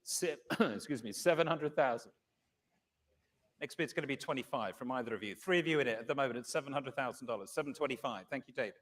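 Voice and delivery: brisk, measured bid calling; fine art auctioneer. Acted. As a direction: call the bids steadily, room to room.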